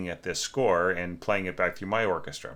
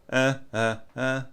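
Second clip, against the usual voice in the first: gentle breathy uh pulses, one breath; mezzo staccato breath pulses; 5 semitones higher than usual. Shown as a demonstration. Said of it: "It's all done in the same breath".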